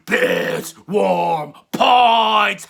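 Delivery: milk the rating like a punchline; drawn-out catchphrase. Acted. Host drawing out his rating catchphrase slowly for laughs. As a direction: stretch every word out long and slow.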